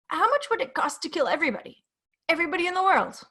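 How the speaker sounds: high, anxious, hesitant asking; nervous man voice. Acted. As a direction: blurt it out nervously. Peter asks the fatal question.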